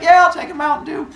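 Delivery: high, quick, eager answer; high Kronos voice. Acted. Kronos accepts the Mountain Dew, high and quick. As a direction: blurt it high and fast.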